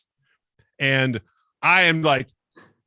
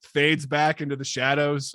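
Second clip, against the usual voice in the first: ordinary talk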